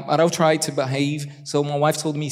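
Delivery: ordinary talk, nothing marked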